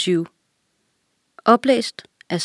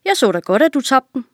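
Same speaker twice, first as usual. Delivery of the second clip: higher, put-on voice of a dim servant; simple servant voice; 6 semitones higher, faster than usual. A narrator's reading. Servant tells the king he saw him drop it, dim voice. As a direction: play the servant dim and matter-of-fact. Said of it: "sagde den dumme tjener".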